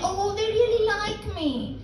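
ordinary talk

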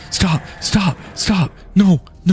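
high-pitched frantic stops; panicked pleading. Real, not acted. Player begging the game to stop, high panicked pleas.

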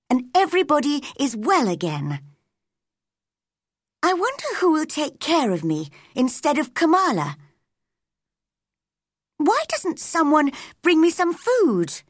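put-on high-pitched child voice, growing fretful; little girl's thoughts. A narrator's reading. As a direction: wonder aloud as a neglected girl.

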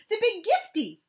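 put-on high, loud excited little boy; a small boy thrilled with his surprise; excited child. A narrator's reading. Matt to Kira, loud excited child voice. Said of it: "Matt called".

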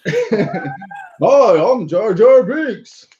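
high, drawn-out goofy impersonation; mock Jar Jar Binks. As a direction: do a hammed-up, high-pitched Jar Jar, slowly. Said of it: "Here's my impersonation".

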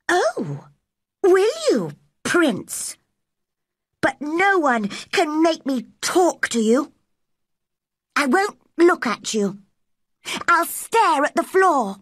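high, scornful retort; defiant girl. Acted. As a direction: defy the little tyrant in a high voice. Mary defies Colin in quarrel.

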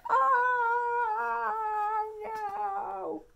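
put-on high, dramatic oh no for the toddler; mock dismay. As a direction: gasp it out in storybook alarm. Animals fly out of mitten.

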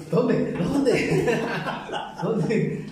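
high, loud, laughing; hammed-up excited question. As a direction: call it out in mock excitement through laughter. Acts out own looking around, laughing.

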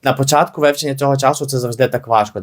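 ordinary talk

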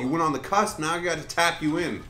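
loud hammed-up telling-off over a missed putt; mock angry scolding. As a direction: chew him out jokingly.

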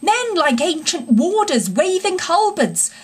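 high-pitched, excited reporting; crying out. A narrator's reading. Engine driver describing the pursuers to Toad, crying out. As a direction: report the sight high and urgent. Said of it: "cried the engine driver".

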